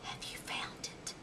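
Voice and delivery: whisper. Acted. Owlet whispering her worry to the mouse. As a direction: whisper it like a lost secret. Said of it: "whispered Ola".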